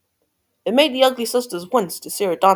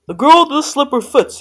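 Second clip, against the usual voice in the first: loud, raised princely proclamation; declaring cry; 7 semitones higher, 7 dB louder than usual. A narrator's reading. Prince vowing to find the girl, crying out loudly. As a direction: proclaim it to the whole kingdom. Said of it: "he cried".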